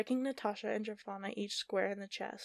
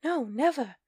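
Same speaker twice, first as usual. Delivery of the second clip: high, loud cry of denial; anguished denial; 6 semitones higher, 10 dB louder than usual. Acted. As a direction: cry out in protest. Natasha denies, anguished.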